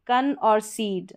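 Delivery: कन और सीड said slowly, split apart; syllable by syllable. Shown as a demonstration. Teacher breaking the word for students, slow and split. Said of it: "इसको भी ... break करना पड़ेगा".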